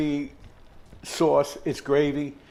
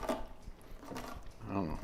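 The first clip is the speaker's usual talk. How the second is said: whispered aside; 5 semitones lower, 16 dB quieter, much slower than usual. Real, not acted. Muttered aside while opening can.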